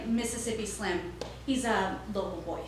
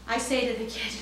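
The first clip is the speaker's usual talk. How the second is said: normal talking voice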